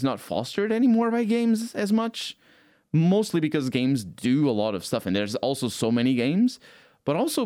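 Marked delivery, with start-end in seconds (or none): none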